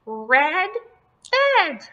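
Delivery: squeaky high Yeti puppet answer; high and pleased, like a little puppet. Pretend.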